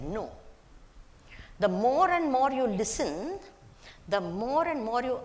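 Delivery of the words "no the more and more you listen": usual speaking voice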